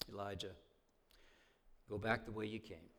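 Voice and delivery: soft, matter-of-fact, gently firm; tender and soft. Retold or imitated. God tells Elijah gently to go back. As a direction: kindly instruct him to go back. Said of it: "it's tender, it's matter of fact, it's soft".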